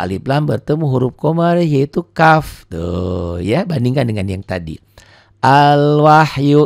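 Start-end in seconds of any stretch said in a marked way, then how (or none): 5.4-6.7 Al-wahyu with the l sounded, slow and loud; careful recitation. Shown as a demonstration. Teacher modelling the word for pupils, slow and loud. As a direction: chant Al-wahyu, lam clear. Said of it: "ini pun dibaca".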